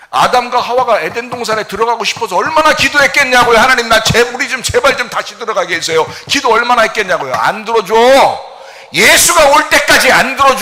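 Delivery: loud, high driving sermon; fervent preaching. Acted. Pastor pressing the congregation about unanswered prayer, loud preaching. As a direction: push it hard from the pulpit.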